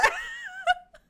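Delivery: shrill burst of laughter; a loud, high laughing shriek. Real, not acted. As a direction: let out a squealing laugh. Reaction to Arte's remark.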